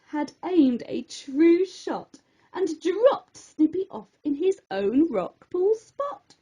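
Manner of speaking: plain reading voice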